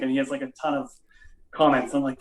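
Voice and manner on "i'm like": normal talking voice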